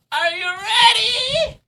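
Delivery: high, soft, drawn-out rising question; teasing build-up. Real, not acted. Streamer teasing viewers before reveal, excited. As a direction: stretch it slowly upward in a small high voice.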